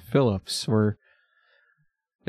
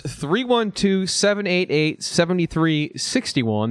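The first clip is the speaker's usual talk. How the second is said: slow, deliberate announcer read of the number; radio ad reading out a hotline; phone number read out; 5 semitones higher, slower than usual. Shown as a demonstration. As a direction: spell it out like an ad. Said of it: "That voicemail number is".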